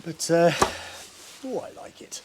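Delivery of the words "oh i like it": whispered. Real, not acted. Admiring, whispered.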